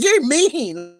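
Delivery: high, sulky mimicry of her; mocking whiny quote. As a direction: whine it like a wounded accuser. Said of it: "It's like".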